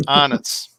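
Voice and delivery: Arnott's said the Aussie way, no r; confirming pronunciation. Shown as a demonstration. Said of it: "let me explain how to pronounce it correctly".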